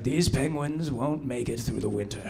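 a hushed, doom-laden presenter; grim Attenborough. Retold or imitated. Nature host predicting the penguins' fate, slow and soft. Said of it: "he's always like".

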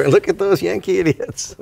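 high-pitched jeering glee; gleeful mockery. Canadians gloating over American elections, joking gleeful jeer. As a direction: crow it in a put-on high voice. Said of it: "I bet Canadians are doing a jig".

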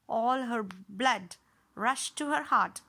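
usual speaking voice

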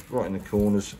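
normal talking voice

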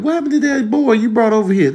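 high-pitched nosy family member; nagging relative. Acted. Relative asking about an old date, nagging. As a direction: pester them in a high mock voice. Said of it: "family members ain't gonna stop asking".

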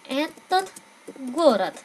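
slow, careful Russian demo phrase; teacher models этот город clearly for students. Shown as a demonstration. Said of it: "chúng ta nói cái thành phố này".

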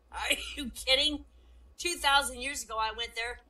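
high, loud, amused incredulity; acted amused outburst; chuckling disbelief. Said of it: "he's chuckling. God says".

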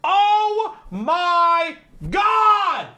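long, high, loud wail of disbelief; hammed-up anguished yell; outraged cry. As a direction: drag out every word in a high betrayed cry. Outraged at magician's reveal.

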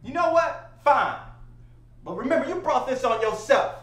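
loud, high-pitched defiant outburst; worked-up giving in. Acted. Man giving in to the cousin, fired-up warning. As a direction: warn her loudly, pitch pushed up.

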